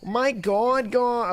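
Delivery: high, put-on Valley girl voice; mock Valley girl accent. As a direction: try a Valley girl, pitched well up. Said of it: "do a good Valley girl".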